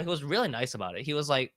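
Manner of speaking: ordinary talk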